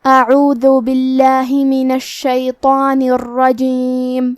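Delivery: slow melodic Quran chanting with long held vowels; tajweed recitation. Acted. Teacher asking listeners to hear the full recitation with tajweed. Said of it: "سماعت فرمائیں".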